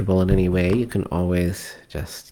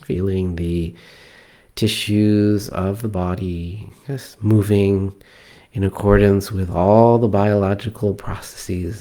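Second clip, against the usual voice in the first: calm meditation guide with long pauses; guided meditation. Acted. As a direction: ease them into relaxed breathing, leaving space between phrases. Leads a breathing meditation.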